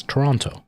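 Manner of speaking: Toronto with a clear second T; non-Canadian pronunciation. Shown as a demonstration. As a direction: sound both Ts in Toronto. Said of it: "everybody else on the planet says".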